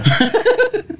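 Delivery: very high squealing laugh. Real, not acted. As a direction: burst into a shrieking laugh. Laughs at glacier doubt.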